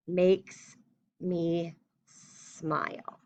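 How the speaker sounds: slow, drawn-out dictation. Shown as a demonstration. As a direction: spell it out as you write. Teacher dictates letter aloud.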